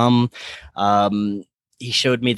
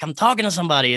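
higher, thrilled retelling; mildly excited, higher voice; retold excitement; 6 semitones higher, much faster than usual. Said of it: "I was so excited about what was happening".

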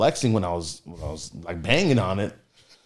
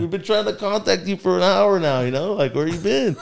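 high, exasperated scolding of a friend; 9 semitones higher than usual. Retold or imitated. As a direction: scold the friend who slept through it. Confronting friend who overslept.

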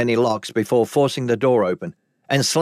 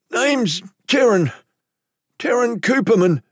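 frightened lowlife; 11 semitones higher than usual. Acted. Captive gives his name under threat.

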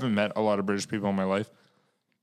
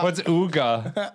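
mock Swedish; 6 semitones higher, 3 dB louder, slower than usual. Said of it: "Uga, Uga".

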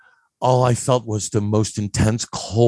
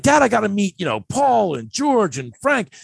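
put-on teenage pleading; 10 semitones higher than usual. Teen begging dad to let him meet friends, pleading. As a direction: plead with dad, acted from memory.

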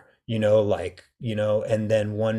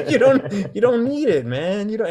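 much higher and louder, laughing through it; laughing dragon voice; 11 semitones higher, 7 dB louder than usual. Retold or imitated. Dragons mock the armored one, laughing high voice. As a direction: tease him while cracking up. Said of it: "all the other dragons are like".

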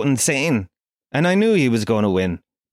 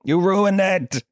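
higher, slower despairing groan; a mock solicitor losing hope; exasperated solicitor; 5 semitones higher, slower than usual. Comedian's imagined solicitor despairs at him, higher and slower. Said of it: "my solicitor would be banging his head".

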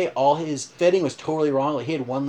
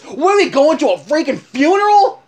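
imitating the buddy flipping out, much higher and louder; furious yell; 12 semitones higher, 10 dB louder than usual. Buddy yelling at the tuxedo shop staff in fury. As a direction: yell it like a mellow guy who finally snapped. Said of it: "He flips out".

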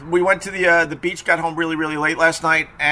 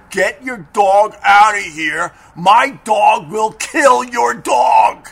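slow, louder, higher imitated yell; mock yelling woman; 6 semitones higher, 6 dB louder, slower than usual. Woman yelling at teller to leave, mock loud slow shout. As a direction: shout it like a panicked, furious woman. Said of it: "the woman gets in my face and goes".

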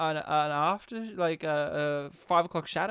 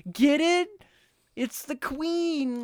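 high, drawn-out mock punchline; over-emphasizing the point; 9 semitones higher, much slower than usual. Mocking films that explain their jokes, exaggerated high nudge. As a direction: spell out the gag in a silly high voice. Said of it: "just to emphasize the point".

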